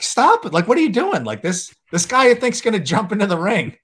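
high, loud mock yell at a guard; retold yell. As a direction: shout up high at the security guard. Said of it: "yelling at the security guard".

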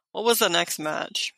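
normal talking voice

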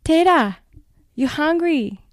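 high, loud Japanese grandmother calling her grandchild; Japanese grandma accent. Retold or imitated. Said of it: "do your Japanese woman accent".

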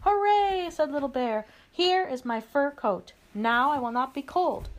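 high, loud little-bear hooray; delighted cheer. A narrator's reading. Little Bear cheers over getting his fur coat, high and delighted. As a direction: cheer brightly as the delighted cub.

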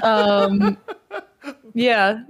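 ordinary talk

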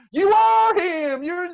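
high, stretched-out excited holler; very loud shout. Acted. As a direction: shout it as if you just recognised a celebrity. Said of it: "It's so loud".

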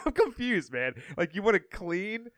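confused pitch rise; baffled. Retold or imitated. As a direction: sound completely baffled. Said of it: "I said".

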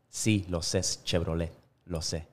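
low, soft dad reply; hushed deadpan dad; understated father. Acted. Father answers child in joke.

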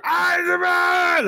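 very high, drawn-out screech; noisy goblin yell. Acted. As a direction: screech while crashing through the bushes. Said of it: "making a ton of noise".

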